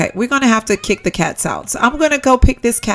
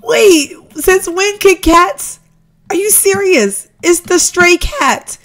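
high, loud disbelieving cry; can't believe the cat got in; shocked disbelief; 9 semitones higher, 5 dB louder, much slower than usual. Real, not acted. Gamer shocked at the stray cat, high loud disbelief.

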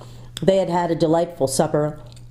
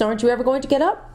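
quick, higher raised call; loud call; 8 semitones higher, faster than usual. A narrator's reading. Jill calling to the sleeping boys, quick raised call. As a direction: hurry them out of bed. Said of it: "shouted Jill in a loud voice".

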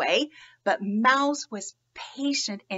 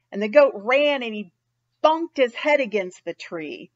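ordinary delivery

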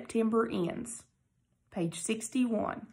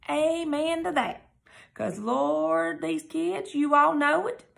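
knowing amen; 6 semitones higher, slower than usual. Acted. Preachy aside about kids.

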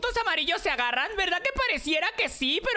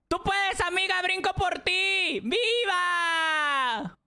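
shouted cheering; much slower than usual. Real, not acted. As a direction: cheer your friend on at full voice. Cheering friend on in game. (porras a gritos)

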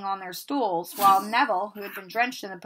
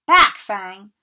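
loud call at the dog; shouted command; 11 dB louder than usual. Acted. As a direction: hold the big dog back. Hagrid holds back the dog.

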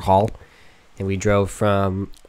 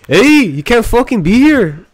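high, loud, drawn-out Canadian Mountie; hammed-up Canadian accent, pitched up; mock Canadian accent; 13 semitones higher, 7 dB louder, slower than usual. Mountie ordering hunters out, mock Canadian accent.

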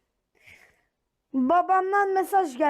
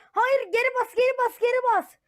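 alarmed; 9 semitones higher, faster than usual. Acted. Backing away from neighbor.

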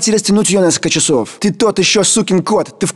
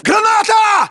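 sharp, very high alarm call; panicked cry of grenade; grenade warning shout; 12 semitones higher, slower than usual. Acted. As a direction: scream the warning to everyone.

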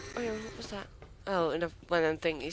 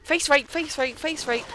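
panicked shouting; 9 semitones higher than usual. Real, not acted. Panicked at creature attack.

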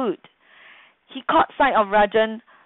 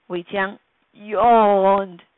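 ordinary talk, nothing marked

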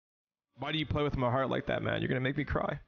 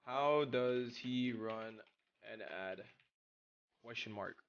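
lower, much softer, slow read-out; reading chat aloud; 7 semitones lower, 7 dB quieter, slower than usual. Shown as a demonstration. Streamer reads a chat question aloud, softly. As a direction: read the message flatly to yourself. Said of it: "reading a chat question aloud".

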